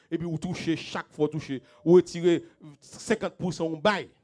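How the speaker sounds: pressing sermon delivery, pushed words; fervent preaching. Acted. Pastor urging the congregation in a fervent preach. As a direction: drive the point home to the flock.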